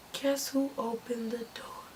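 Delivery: hushed, breath-only tease; suspenseful whisper. A narrator's reading. Narrator teases listeners about who is at the door, whispered. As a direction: whisper it like a secret reveal.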